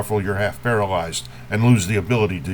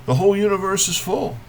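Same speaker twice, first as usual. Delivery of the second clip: high, quick refrain lifted out of the verse; brisk poetic refrain, pitched well up; raised refrain; 8 semitones higher, faster than usual. Acted. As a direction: say the whole line up high without lingering. Refrain of poem, returning higher.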